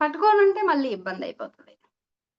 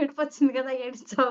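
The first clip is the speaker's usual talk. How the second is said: quick, laughing retold scolding; faster than usual. Teasing a grieving friend, laughing.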